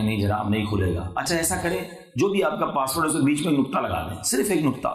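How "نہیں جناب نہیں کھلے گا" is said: lower, lightly voiced protest; objecting listener. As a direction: play the doubting listener, lower. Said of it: "آپ کہیں گے". (شک کرنے والے سامع کی ہلکی نقل)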